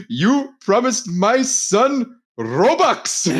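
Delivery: slow, high mock Indian accent, laughing through it. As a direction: drag out a put-on Indian accent while cracking up. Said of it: "like that Indian guy".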